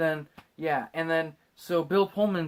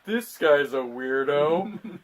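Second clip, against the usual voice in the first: very slow, drawn-out judgment; slow mock-wary verdict; deadpan disbelief; 2 semitones lower, much slower than usual. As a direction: stretch it out, unimpressed by the oddball. Acting deadpan reaction to oddball.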